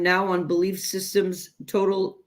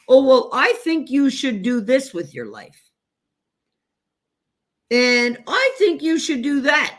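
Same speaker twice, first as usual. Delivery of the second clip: higher, louder imitation of pushy advice; mock meddling parent; 6 semitones higher, 4 dB louder than usual. Parent telling a child how to live, mock insistent. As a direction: press your opinion like an interfering parent. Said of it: "How many times did our parents".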